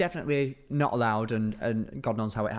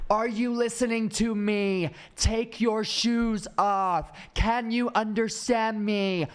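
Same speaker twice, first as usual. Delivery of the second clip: slow, condescending American guard talking down; lightly voiced rude officer, each word drawn out; mock rude guard; 10 semitones higher, slower than usual. Guard humiliating Korean travellers, slow condescending voice. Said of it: "this man was trying to publicly humiliate them".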